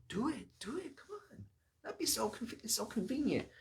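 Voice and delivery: very high, squeaky goading voice; urging henchmen. Acted. David's men pushing him to kill Saul, urging. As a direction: egg him on in a high, excited voice.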